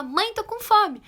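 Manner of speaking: high, loud child imitation; mock urgent yell for mum; shouting child. Hungry small daughter calling her mother, shouting. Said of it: "a criança falou gritando".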